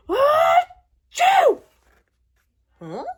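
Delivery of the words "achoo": a big high shouted sneeze; loud shouted achoo. Acted. Gerald sneezes on Piggy, a loud high achoo. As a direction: blast the sneeze out.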